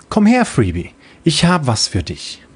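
a hint of a reassuring helper; lightly voiced kind bot. The bot comforting the child, lightly voiced friendly bot. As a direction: explain it with gentle pride.

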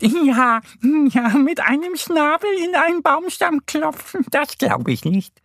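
very high, amused cartoon duck voice; laughing duck. A narrator's reading. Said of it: "Annie lacht".

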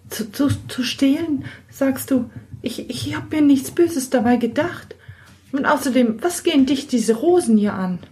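put-on high girlish voice, stammering in alarm; she's caught out and bristles back; young girl, flustered and defiant; startled young girl.

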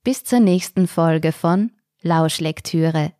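host closing the story podcast; podcast outro. Acted. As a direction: sign off the episode warmly.

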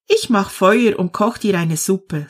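ordinary delivery, nothing marked

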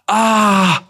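high, loud curse of realisation; a hammed-up damn-it moment; mock dismay. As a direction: blurt it out as if caught out. Realising the wrong name, cursing.

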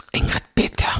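imitating a hushed reproach; acted whispered telling-off; whispered rebuke. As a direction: whisper a mock scolding. Sharp rebuke quoted, unvoiced.

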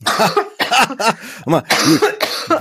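laughing. Real, not acted. Teasing the coughing partner.

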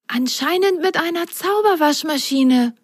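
high little sock voice; Belly answering softly. Acted. Belly softly explaining the magic washing machine. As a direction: answer him quietly. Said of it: "antwortete Belly ihm leise".

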